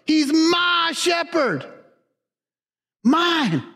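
fervent preaching peak; emphatic shout. Real, not acted. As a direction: cry it out with conviction. Climactic preaching.

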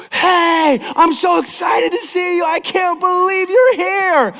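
a friend thrilled to see you, hammed-up; gushing excitement. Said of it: "and another person said".

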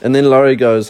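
usual speaking voice

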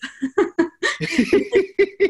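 real high laughter. Real, not acted. Laughing at Gary's joke.

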